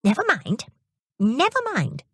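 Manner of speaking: high, soothing grandmotherly never mind; reassuring old lady. Acted. Old lady soothes upset Jimmy, gently reassuring. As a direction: comfort the upset boy. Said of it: "she said".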